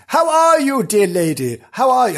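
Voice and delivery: high, loud, theatrical greeting; exaggerated old-fashioned politeness; mock gallant gentleman. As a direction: hail her with hammed-up chivalry. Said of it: "they wouldn't speak like that".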